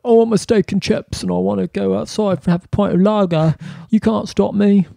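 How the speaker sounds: high, mock British tourist accent; bad British accent. British tourists screaming at Spanish police, in a bad British accent. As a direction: rant like a rowdy British tourist. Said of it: "mine, that was off".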